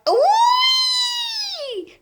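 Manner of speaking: piercing high "whee" yell; long high yell. A narrator's reading. Grandma jolted by the medicine, long high yell. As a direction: let out one long, very high squeal. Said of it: "Grandma yelled".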